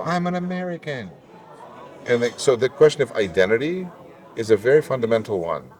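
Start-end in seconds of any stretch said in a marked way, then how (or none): 0.0-1.1 much higher, ringing declaration; emphatic retort. Retold or imitated. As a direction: fire it back, high and proud. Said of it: "I go".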